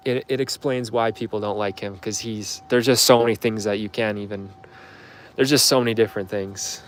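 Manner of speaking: ordinary talk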